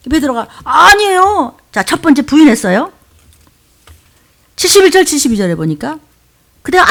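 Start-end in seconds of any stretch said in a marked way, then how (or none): 0.6-1.6 high, drawn-out acted protest; playing a flustered denier; emphatic denial. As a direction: protest your innocence in a raised voice. Acts Peter's denial.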